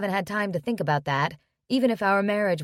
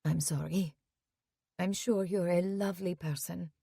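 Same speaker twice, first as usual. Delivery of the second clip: put-on much softer, calmer French-accented apology; softened apology; 6 dB quieter than usual. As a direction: drop the voice, apologise gently. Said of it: "She pauses".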